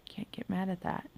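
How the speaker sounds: whispered aside; soft unvoiced aside. Real, not acted. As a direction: whisper it like a little secret. Aside to herself.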